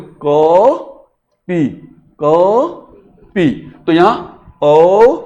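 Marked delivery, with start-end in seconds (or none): 0.0-3.7 "को" stretched long before a separate "पी"; two-beat vowel. Shown as a demonstration. Teacher modelling the doubled length, long "को", then "पी". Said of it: "इसलिए इसको बोलेंगे". 4.6-5.2 "ओ" louder, held long and rising; long vowel. Shown as a demonstration. Teacher showing two beats, loud drawn-out "ओ". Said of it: "इस प्रकार से".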